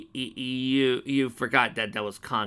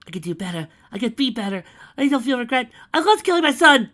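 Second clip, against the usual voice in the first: rising higher and louder into frenzy; frantic pleading; 13 semitones higher, 12 dB louder than usual. Acted. Mare frantically pleading with Conquest, climbing to a high loud frenzy. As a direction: beg faster and higher each line. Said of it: "forcing a laugh".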